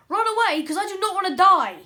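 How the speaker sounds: high, loud panicked cry. Acted. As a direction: yelp it out in panic. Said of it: "if I sound like a girl".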